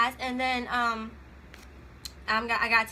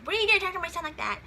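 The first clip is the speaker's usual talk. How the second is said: higher, fast, indignant mom voice; offended mom; 6 semitones higher, much faster than usual. Retold or imitated. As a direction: snap back like a mother defending her son. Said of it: "And you got the mom like".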